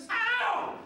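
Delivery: high, loud yelp of pain; hypnotized volunteer feeling a splinter. Pretend. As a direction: yell as if stung.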